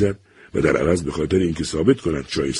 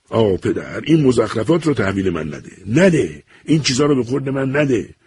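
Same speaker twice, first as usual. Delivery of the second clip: higher, exasperated protest building; losing his temper; 7 semitones higher than usual. A narrator's reading. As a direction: plead angrily, fed up. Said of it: "که دیگر از کوره در رفته بود".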